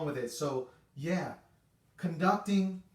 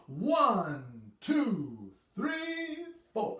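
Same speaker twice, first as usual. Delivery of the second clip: drawn-out beat count; slow one two three four; 6 semitones higher, much slower than usual. Shown as a demonstration. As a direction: count the beats slowly. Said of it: "nice and slow".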